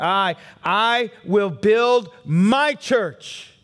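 high, loud, triumphant sermon climax; booming preacher proclaiming triumph; full-force preaching. Acted. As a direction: preach it like the victory is won.

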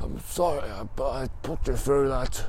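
high, feeble patient's voice; acted weak apology. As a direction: imitate a drained patient struggling to apologise. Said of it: "he hasn't got much energy".

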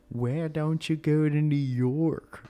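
put-on a failed New York accent, slow and loud; bad New York accent. Fan asking about New York, in a bad New York accent. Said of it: "I can't do a New York accent".

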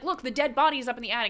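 wife insisting to husband during argument, fast and urgent; insistent. Retold or imitated. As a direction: press him, rushing the words.

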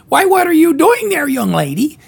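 put-on high, loud old granny falsetto; Granny Loomis voice. Granny greets a girl in a high, loud voice. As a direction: squeak it out like a fussy old granny. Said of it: "and she says".

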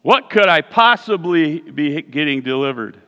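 ordinary talk, nothing marked

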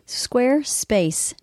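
Squarespace drawn out slowly, stretched syllables; slow brand tag. Shown as a demonstration. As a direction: savour the name Squarespace slowly.

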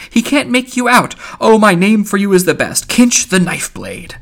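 loud, animated, high-swooping ranter; breezy scornful Mulligan. Acted. Mulligan mocks the Oxford Englishman to Stephen, breezy scorn. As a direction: rail merrily with the pitch leaping high. Voices Mulligan's speech in the story.

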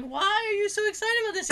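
high, quick mocking question; making fun. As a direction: tease him with a rushed, high-pitched question. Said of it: "I started making fun of him".

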